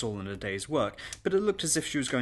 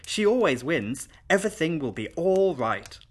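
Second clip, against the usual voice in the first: lightly voiced confident child, higher and louder; calm little girl; 6 semitones higher, 5 dB louder than usual. Little girl vouches for governess to guests, calm certainty. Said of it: "said Twyla calmly".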